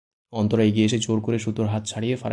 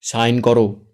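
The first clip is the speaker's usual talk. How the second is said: much louder sharp command; louder barked command; loud rebuke; 9 dB louder, much slower than usual. Acted. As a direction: bark the order. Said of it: "জোরে আরেকবার ধমকে বলে".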